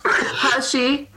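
high, doubtful "Has she?"; sarcastic, question it pointedly; sarcastic disbelief. Real, not acted. Doubting mom's claim.

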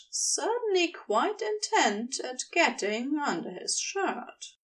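usual speaking voice

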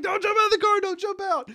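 high, loud frantic pleading; urgent calling out. Retold or imitated. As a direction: stop him from jumping, fast. Mom urgently stopping friend.

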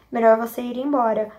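plain reading voice

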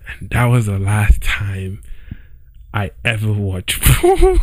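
cracking up on the word porn; laughing. Real, not acted. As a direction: try to stay serious but break into a laugh. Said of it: "I can't even say this with a straight face".

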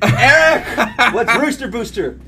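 high, loud mock asker, half laughing; hammed-up eager questioner; prompted question. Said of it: "I was waiting for someone to ask".

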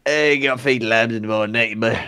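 put-on broad Norfolk farmhand telling the boy off; lightly put-on Norfolk yokel; Norfolk farmer voice. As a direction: do a rural Norfolk farmer nagging about the lambs. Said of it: "the sayings they come out with in Norfolk".

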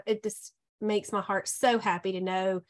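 normal talking voice

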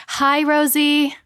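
usual speaking voice

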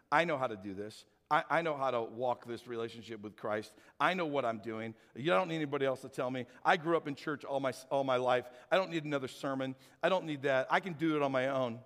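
low-pitched, know-it-all dismissal; self-assured dismissal. Acted. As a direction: brush off help in a low, settled voice. Voices self-reliant churchgoer's attitude.

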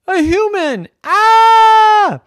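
very high, loud shriek of fright; shock scream. Acted. Storyteller reveals the human walking in with a high loud shriek. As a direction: scream as the door opens.

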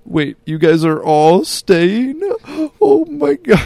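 slow, high, loud teary wail rising to a squeal; mock crying wail. Sister learns friends are staying, hammed-up crying wail. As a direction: ham up the sister bursting into tears again. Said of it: "a delayed second round of crying".

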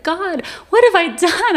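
high, loud, anguished cry to God; retold sobbing plea. Woman just woken from a dream cries to God, acted sobbing plea. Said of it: "and I'm sobbing".

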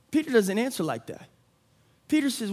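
ordinary delivery

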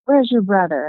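imitated mad dad. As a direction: act out an angry father demanding an answer. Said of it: "at first he was mad".

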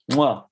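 loud smacking kiss of approval; mock chef's kiss smack. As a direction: kiss your fingertips like a chef. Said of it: "The chef's kiss".

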